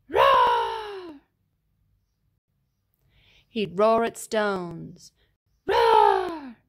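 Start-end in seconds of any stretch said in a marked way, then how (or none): 0.1-1.2 big high shouted dinosaur roar; loud roar. Acted. Allosaur roaring all night and day, full shouted roar. 5.7-6.6 loud shouted mock roar; dinosaur roar. Allosaur roaring at stones, loud playful roar.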